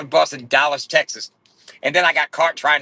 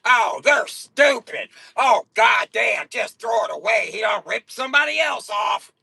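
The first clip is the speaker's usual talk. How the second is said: high, slow mock-outraged shouting haters; 9 semitones higher, slower than usual. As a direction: shout like jealous haters losing it. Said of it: "They be like".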